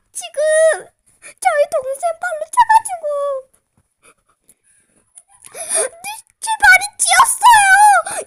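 mock the sister, high and whiny; sister impression. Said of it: "우리 언니 성대모사 했어요".

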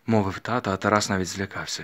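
ordinary delivery

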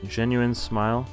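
ordinary talk

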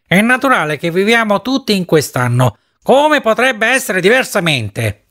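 a lightly shrill appeal to the others; shrill and high. A narrator's reading. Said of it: "in tono acuto e stridulo".

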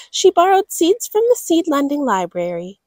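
ordinary delivery, nothing marked

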